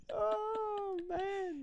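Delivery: laughing through the words; real laugh, voice cracking up; laughing hard. Real, not acted. Bursts out laughing at joke.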